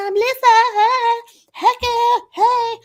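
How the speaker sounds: very high, loud, squealy schoolgirl hello; gushing girly greeting. Retold or imitated. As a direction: squeal hello like excited teen friends. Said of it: "exactly how we did it".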